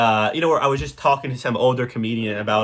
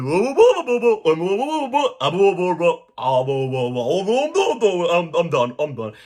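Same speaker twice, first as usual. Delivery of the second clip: doughy guy impression; 3 semitones higher than usual. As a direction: ham up a dopey guy babbling boo boo. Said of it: "some doughy fucking guy to be like".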